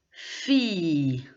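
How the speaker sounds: fille as fee-y, long and falling; silent double L. Shown as a demonstration. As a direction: draw out fille, gliding to y without an L. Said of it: "on ne prononce pas vraiment le L". (« fille » prononcé fi-ye, sans le L)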